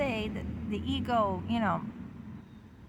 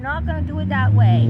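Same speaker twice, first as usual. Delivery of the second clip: deep mock voice; 11 dB louder than usual. Ego answers the id.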